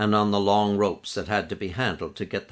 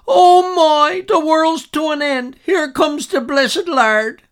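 shrill panicked scream, much higher and louder; hollered out; 11 dB louder, slower than usual. Retold or imitated. Girl at the window shrieks as the Zeppelin comes, terrified scream. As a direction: scream the end of the world, drawing it out. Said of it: "hollered out".

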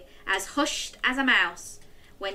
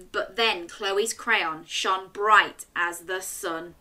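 ordinary delivery

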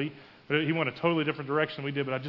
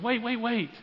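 high, urgent mock call without much volume; retold near-yell, high-pitched; almost yelling; 7 semitones higher, slower than usual. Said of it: "the lady almost yells at him".

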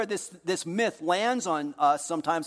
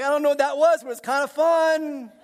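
high, loud bemused voice; a baffled stranger amused anyway; puzzled passerby; 9 semitones higher, 6 dB louder than usual. Retold or imitated. Stranger leaving confused by the reply, high and bemused. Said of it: "It's like".

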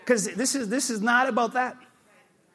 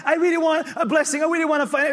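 mock pious whining; 7 semitones higher, 4 dB louder than usual. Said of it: "You ever heard people talk like that?".

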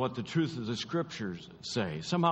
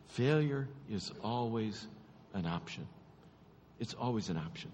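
hushed refrain; 3 semitones lower, 7 dB quieter than usual. Real, not acted. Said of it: "refrain repeated softly".

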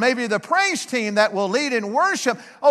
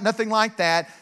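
ordinary talk, nothing marked

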